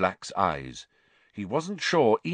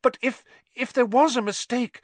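put-on girl voice; 14 semitones higher, 4 dB louder than usual. Hermione pleading, voiced high.

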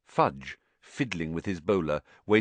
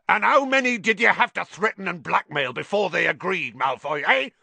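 loud, high angry roar; 12 semitones higher, 6 dB louder than usual. A narrator's reading. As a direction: roar the accusation at him. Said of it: "he roared".

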